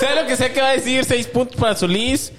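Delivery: anuncio de puntos agudo y emocionado; shouting match. Real, not acted. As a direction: proclamarlo como presentador. Anuncia puntaje a gritos. (anunciando los puntos a gritos)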